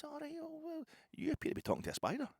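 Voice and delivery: squeaky high spider voice, cocky; talking spider. Pretend. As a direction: play the spider sneering at the big man. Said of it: "Now he says".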